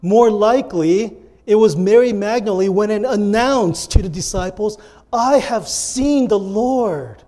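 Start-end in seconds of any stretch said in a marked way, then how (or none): 5.1-7.2 announcing. Shown as a demonstration. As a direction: announce it like amazing news. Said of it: "That's announcing. That's not reading".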